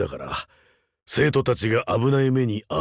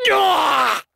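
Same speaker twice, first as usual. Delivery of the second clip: very high, loud yell; all-out fighting yell; full battle yell; 4 dB louder than usual. Acted. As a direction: throw everything into the strike. Battle shout mid-attack.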